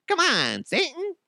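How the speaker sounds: high, rising coax of Satan; pleading call to Satan. Retold or imitated. Saddam calling out to Satan, high pleading coax. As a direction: coax him in a squeaky high plea.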